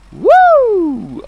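very high loud woo; owl call. Pretend. Streamer hooting chat's owl impression, high and loud. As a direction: whoop it like an owl. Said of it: "I'll do your impression of what I read".

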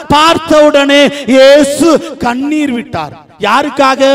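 high, driving pulpit narration; impassioned preaching. Real, not acted. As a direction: proclaim the story phrase by phrase. Preaches the story at full pitch.

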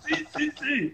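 very high, soft yeses through laughter; laughing mock yes. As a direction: laugh while mimicking the eager guest. Retelling the eager yes, laughing.

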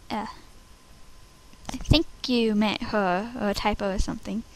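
fast unvoiced whisper; whispered aside. Real, not acted. Aside about script.

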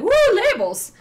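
whooped retelling of excitement; mock excited cheer. Scrapbooker recalling her love of labels on moving, excited cheer. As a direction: give a playful, rising whoop of delight.

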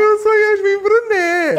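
high, loud, stretched cry; shouted laughing exclamation; excited shout. Real, not acted. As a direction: yell the realization in disbelief. Exclaiming the realization.

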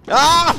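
loud, high startled scream. Real, not acted. Player attacked in game, startled scream. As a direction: scream out in alarm.

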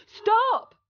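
a sudden high shouted command. A narrator's reading. Apprentice shouting at the flooding broom, commanding shout. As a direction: bark it high and sharp at the broom. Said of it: "commanded the boy".

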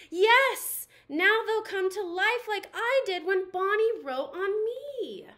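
little toy thrilled his new friends will wake; excited Forky. Acted. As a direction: bubble over with hope. Forky excited crafts will live.

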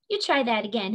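ordinary delivery

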